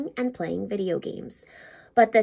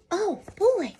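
put-on much higher, excited little boy; Billy thrilled to help, much higher; eager thanks; 11 semitones higher, much slower than usual. A narrator's reading. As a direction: bounce up with delight. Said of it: "said Billy".

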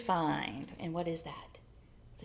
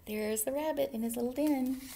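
ordinary delivery, nothing marked

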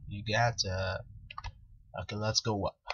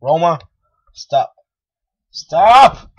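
calling Roma off, louder each time; shouting at the dog; 3 semitones higher, 14 dB louder, slower than usual. Real, not acted. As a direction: stop the dog, rising to a scream.

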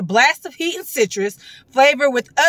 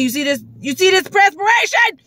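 excited; 7 semitones higher than usual. Real, not acted. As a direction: show off the sweat in disbelief. Showing sweat to camera.

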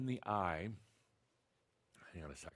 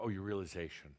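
ordinary delivery

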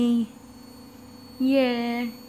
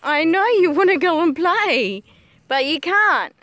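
loud, playful commiseration; emphatic pet talk; 3 semitones higher, 7 dB louder than usual. Real, not acted. Owner telling dog it can't go play, loud baby talk. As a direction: tell the dog no, loudly but fondly.

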